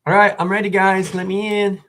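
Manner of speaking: playful, high-pitched holler to be let in; calling to be let in. Pretend. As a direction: call out as if knocking at the door. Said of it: "it's almost like I'm knocking at the door".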